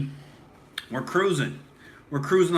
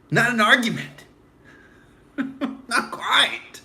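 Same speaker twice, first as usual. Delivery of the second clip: much higher mocking voice; mocking squeal; 8 semitones higher, slower than usual. Host mocking Bill Nye, much higher. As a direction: squeak it out to ridicule him.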